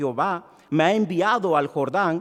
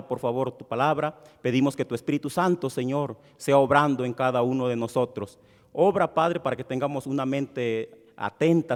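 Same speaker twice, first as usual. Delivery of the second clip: prayer; 4 semitones lower than usual. Acted. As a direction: pray humbly over the gathering. Leads congregation in prayer.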